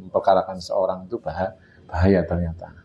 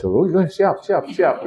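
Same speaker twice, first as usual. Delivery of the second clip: eager mock agreement; 9 semitones higher, slower than usual. Mimicking an eager yes-man.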